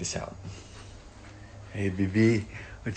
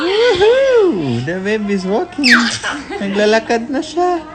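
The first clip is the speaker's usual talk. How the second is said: loud high whoop; cheering; 5 semitones higher, 7 dB louder than usual. Real, not acted. Mother cheering her baby's first steps, loud whoop.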